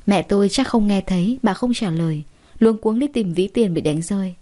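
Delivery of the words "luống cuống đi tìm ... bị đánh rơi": ordinary delivery, nothing marked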